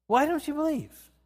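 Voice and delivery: high, astonished question. Acted. Jesus to his disciples, astonished and high. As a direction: ask it in high disbelief at their doubt. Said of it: "he's astonished at their unbelief".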